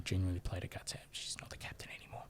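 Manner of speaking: whispered aside to the mic. Real, not acted. Host whispering a correction to co-hosts. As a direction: let them in on a secret, under the breath. Confiding aside about the captaincy.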